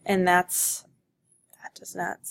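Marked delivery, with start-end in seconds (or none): 1.6-2.2 whispered aside. Real, not acted. Teacher mutters aside to herself.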